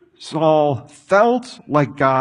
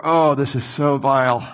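ordinary talk

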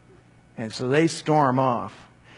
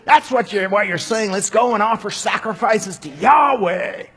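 shouting; 8 semitones higher, 7 dB louder than usual. Acted. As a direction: rage at them, stretching each word. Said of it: "Pharaoh shouted".

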